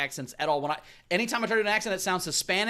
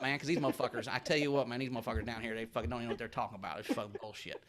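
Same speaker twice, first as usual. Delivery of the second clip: hillbilly accent; 4 semitones lower than usual. Shown as a demonstration. Comic shows off his hillbilly accent, mumbly and ever lower. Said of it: "can you do hillbilly Clinton?".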